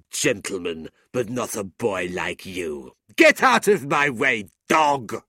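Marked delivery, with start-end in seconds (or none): 3.2-5.2 high, loud insult; angry order. A narrator's reading. Gentleman ordering challenger aside, angry. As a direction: shout him out of your way.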